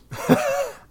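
sudden high burst of laughter; real laugh cutting off the anger; laughing at himself. Real, not acted. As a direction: break into a laugh at your own rant. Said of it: "I don't even know why I'm mad".

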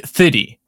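"thirty" with no r sound, like "thitty"; South African "thirty". Shown as a demonstration. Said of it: "How did you used to say thirty?".

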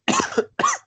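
single sharp cough. Real, not acted. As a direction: cough once mid-sentence.

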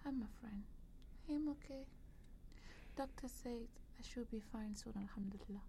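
faint whisper, putting on a brave face; weak whisper. Acted. Sick woman telling her friend she'll be fine, a weak whisper.

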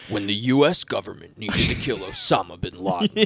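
deep dramatic trailer voice; movie trailer voice. Pretend. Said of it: "It's like a movie trailer".

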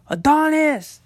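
high calling shout; shouted name. Retold or imitated. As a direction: yell the name from a distance. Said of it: "I hear somebody scream".

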